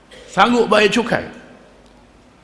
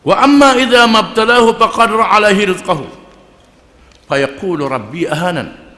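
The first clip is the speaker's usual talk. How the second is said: Quran recitation; 4 semitones higher, 4 dB louder than usual. Acted. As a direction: intone it like tilawah. Quran verse recited in sermon.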